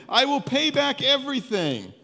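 high desperate promise. Acted. Servant on his knees begging master, high and desperate. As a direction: voice lifted high, pleading to be spared.

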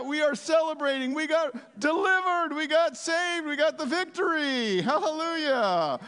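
high, jubilant victory shout; exuberant celebration. Acted. Preacher celebrating deliverance with the congregation, exultant shouting. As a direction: cry it out like a winning crowd.

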